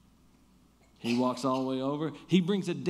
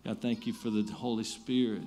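soft, lower, unhurried prayer voice; 5 semitones lower, 5 dB quieter than usual. Acted. Pastor leading the church in prayer, soft and reverent. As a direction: pray quietly and reverently.

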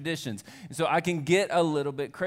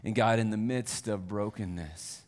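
prayer; 4 semitones lower than usual. Acted. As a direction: pray gently over the people. Pastor prays over the congregation.

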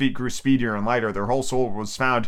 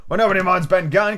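cry; 6 semitones higher, 3 dB louder than usual. A narrator's reading. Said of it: "cried Mary".

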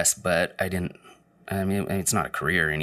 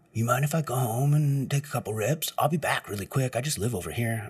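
stoner coworker; 4 semitones higher than usual. Retold or imitated. Stoner asks to leave.

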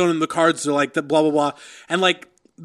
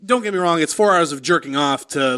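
ordinary talk

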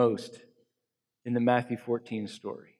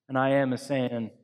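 normal talking voice

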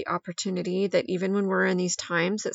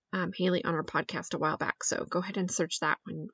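normal talking voice

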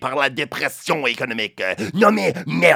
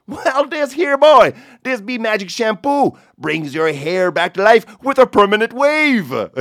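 Newfie punchline; 7 semitones higher, 5 dB louder than usual. Retold or imitated. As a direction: deliver the punchline like a proud Newfoundlander. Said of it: "avec ma meilleure imitation du merveilleusement charmant accent celtique".

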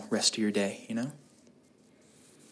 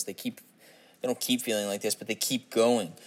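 ordinary talk

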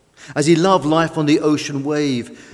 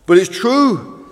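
higher, louder, slow insistence; snapped; 6 semitones higher, 7 dB louder, slower than usual. A narrator's reading. Fish insists to Tug that sinking is real, snapping. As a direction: snap back in the old boat's voice. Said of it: "snapped Fish".

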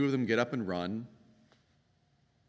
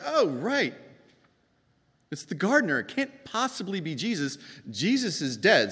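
much higher, louder grief-struck resignation; grieving Mary; 9 semitones higher, 5 dB louder than usual. Acted. Grieving Mary dismisses the gardener, high and loud. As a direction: blurt it out numb with grief. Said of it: "So in her grief".

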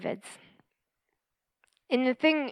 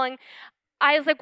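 normal talking voice

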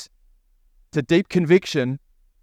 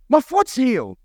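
high, astonished cry, acted out; hammed-up high-pitched amazement; imitated shrieking; 9 semitones higher than usual. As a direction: squeal it like a man who cannot believe it. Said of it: "he shrieked".

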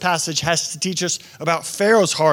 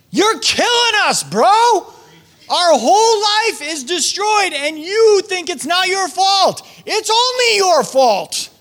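much higher, louder, slow exasperated plea; desperate servants; 11 semitones higher, 4 dB louder, slower than usual. Retold or imitated. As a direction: hammer home that it's his fault. Servants voiced confronting Pharaoh.

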